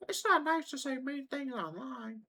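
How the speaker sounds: very high, prissy mock of a goody-goody; mocking preachy voice. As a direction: parody a sanctimonious scold in a squeaky voice. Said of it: "Don't be like".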